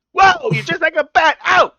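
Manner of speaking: high, loud, slow mock shout; Penguin shouting. Penguin slammed by Batman in a high, loud, slow mock shout. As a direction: howl like a mobster slammed into a wall.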